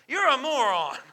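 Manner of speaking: high, loud retold insult; mock exasperation. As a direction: burst out with a playful mock outburst. Wife's exasperated thought, retold.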